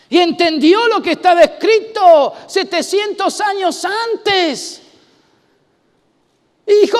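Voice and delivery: loud, driving sermon voice; preaching at full force. Acted. Pastor preaching loud to the congregation about applause. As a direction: preach it out to the whole room.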